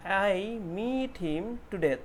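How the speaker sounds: "meet" with a long stretched ee; slow, stretched teaching read of "meet". Shown as a demonstration. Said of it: "एक ई, long ई sound". ("meet" में लंबा ई खींचकर)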